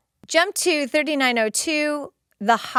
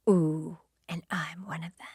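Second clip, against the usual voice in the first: whispered threat; 6 semitones lower, 10 dB quieter than usual. Retold or imitated. Quoting the monster's threat.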